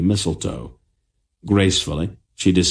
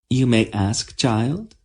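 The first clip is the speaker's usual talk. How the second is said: gently higher queen's voice; kind queen; 5 semitones higher, slower than usual. A narrator's reading. The queen answering Tenedra, high and kind. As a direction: answer the girl fondly.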